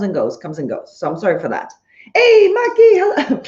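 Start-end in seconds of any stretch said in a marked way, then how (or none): 2.1-3.4 high, loud call to a friend. Real, not acted. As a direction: call out happily across the room. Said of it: "don't make me shout".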